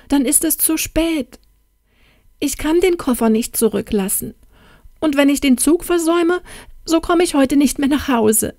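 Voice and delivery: high, plaintive near-crying voice; distressed wail, pitched well up; tearful despair. A narrator's reading. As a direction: lament it as if close to sobbing. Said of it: "Ihre Stimme erstickte in Schluchzen".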